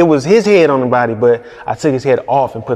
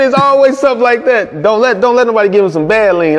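usual speaking voice

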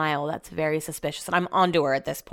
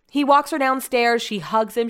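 usual speaking voice

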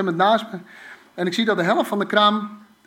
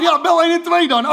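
high, loud command to call 112; urgent order; 9 semitones higher, 7 dB louder than usual. Retold or imitated. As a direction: bark the order at frozen staff. Ordering staff to call emergency.

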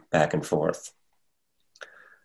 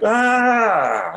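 a short, high, louder mock scream; scare-off yell; 4 dB louder than usual. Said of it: "You just go like".

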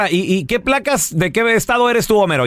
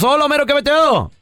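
lively radio greeting; 8 semitones higher than usual. Real, not acted. Host greeting caller on air.

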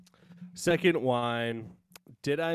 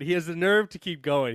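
usual speaking voice

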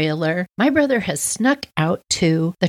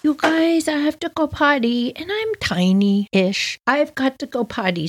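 put-on little girl; 7 semitones higher than usual. Little girl needs the bathroom.

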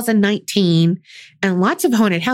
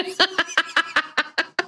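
high, softer run of giggles; long laugh; 9 semitones higher, 6 dB quieter than usual. Real, not acted. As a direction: keep giggling helplessly. Both crack up over vampires.

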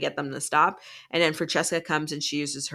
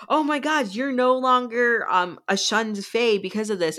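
much higher, thrilled outburst; excited gasp; 6 semitones higher, 3 dB louder than usual. Acted. Jerry spotting the rings, high thrilled outburst. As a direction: burst out in delight at the news.

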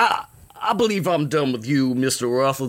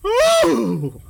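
shocked high squeal; 4 dB louder than usual. Acted. Shocked at bare-assed guest.

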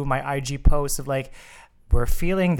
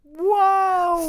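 long, very high drawn-out wow; swooning, high-pitched wow; mock-awed wow; 4 dB louder than usual. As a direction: hold a high, playful cheer on one word. Co-host cheers the congratulations.

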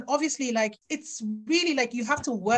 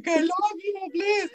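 high pleading beg; "Please, take my work" begged high and slow; mock groveling; 6 semitones higher, 3 dB louder, much slower than usual. Writer begging the agent on speakerphone, mock pleading. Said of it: "I can't grovel and be like".

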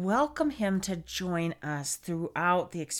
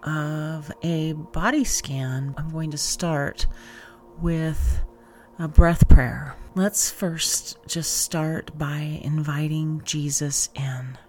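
even, low-key and deliberate, phrase by phrase; guided meditation; 4 semitones lower, 5 dB louder than usual. Acted. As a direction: guide listeners gently through a breath prayer and body scan, unhurried. Leads body scan meditation.

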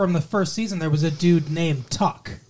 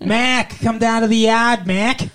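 loud, higher-pitched mock Boston accent; 6 semitones higher, 5 dB louder than usual. Boyfriend mocking how girlfriend talks, loud Boston accent. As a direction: call out loudly in broad Boston. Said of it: "You sound like you're from Boston".

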